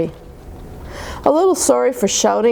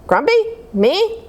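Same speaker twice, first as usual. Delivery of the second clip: indignant squeak; 10 semitones higher than usual. Acted. Jim denies being grumpy.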